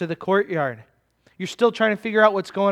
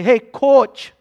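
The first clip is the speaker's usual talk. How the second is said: loud, higher imitated Minnesota call; northern Minnesota accent; 4 semitones higher, 8 dB louder than usual. Girls playing basketball call their coach, northern Minnesota accent. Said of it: "you're definitely from northern Minnesota".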